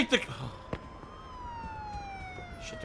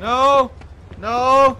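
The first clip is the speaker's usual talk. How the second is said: panicked shout; 3 semitones higher, 5 dB louder than usual. Real, not acted. Cops chasing him.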